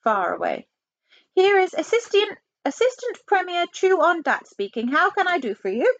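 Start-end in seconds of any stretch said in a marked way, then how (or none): 1.3-6.0 put-on high Chinese-accented official voice; high, courteous phone voice; Chinese assistant premier. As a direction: pitch it up as a polite caricatured official.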